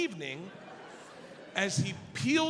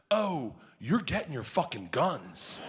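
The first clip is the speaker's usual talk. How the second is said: slow, lower, emphatic vow; imitating a dead-serious dealer; 6 semitones lower, slower than usual. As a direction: spell it out slowly, in all seriousness. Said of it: "he looks at me dead serious".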